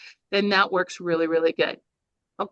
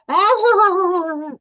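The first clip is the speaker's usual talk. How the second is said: a sudden high, loud burst of audience laughter; mock laughter wave; 9 semitones higher, 7 dB louder than usual. Shown as a demonstration. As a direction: blast it out like the crowd cracking up.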